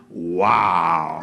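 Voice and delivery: low, loud wow of wonder. Acted. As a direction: shout your amazement in a low voice. Everyone amazed.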